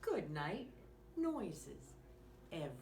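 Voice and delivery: put-on lively bedtime story read to a small child; reading to children. Reading a bedtime story to young listeners, lively and gentle. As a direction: read it like a bedtime picture book. Reads picture book aloud.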